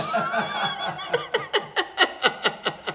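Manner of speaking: high-pitched laugh at his own punchline; cracking up at the punchline. Real, not acted. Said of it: "teller laughs at own joke".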